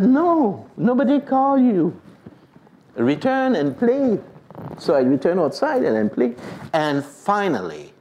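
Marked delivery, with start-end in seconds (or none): 0.0-4.2 mother's reply. Retold or imitated. Mother answers, impatient.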